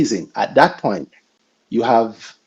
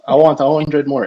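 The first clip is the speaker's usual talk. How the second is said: ordinary talk, nothing marked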